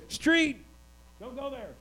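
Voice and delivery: a high yelp of "Street!", then a quick hushed "don't go there"; a parent yanking a toddler back from the road; warning a toddler. Retold or imitated. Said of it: "you would say".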